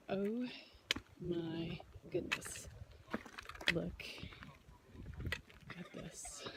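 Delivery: hushed, awed, slow; breathless with wonder; awed whisper. Real, not acted. Awed at the overlook view.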